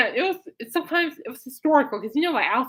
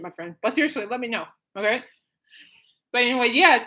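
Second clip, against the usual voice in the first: ordinary talk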